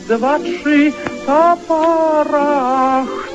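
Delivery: ordinary talk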